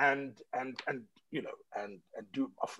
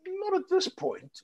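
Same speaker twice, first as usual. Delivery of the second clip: high, slow acted reply; polite deflection; 14 semitones higher, slower than usual. Declining a move to Canada, high slow deflection. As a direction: draw it out high, politely putting her off.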